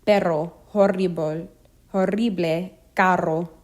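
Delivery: RR trilled in Perro, horrible, carro; rolled double R. Shown as a demonstration. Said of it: "a trill or tongue roll".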